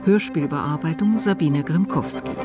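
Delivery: usual speaking voice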